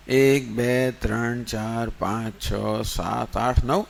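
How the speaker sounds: low, soft count, one by one; quiet counting. Acted. The leader counts his friends standing in line, low and soft. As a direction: count the men under your breath. Leader recounts, finds only nine.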